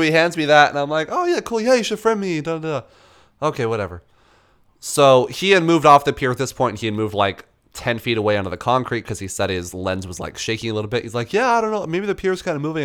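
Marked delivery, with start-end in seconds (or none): 1.1-2.9 high, sing-song fake enthusiasm; fake cheerful. Narrator mimics his own fake cheer toward the photographer, high. 11.3-12.8 a higher mock of the old guy; lightly voiced old photographer. Mocking the photographer's excuse about the pier, lightly higher. Voicing Ernie.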